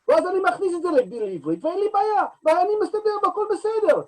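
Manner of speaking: Hebrew in his Israeli accent, high and fast. Shown as a demonstration. Actor showing how he speaks Hebrew, in Israeli accent. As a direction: rattle off Hebrew in the Israeli accent, pitched well up. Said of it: "I take that same way of pronouncing".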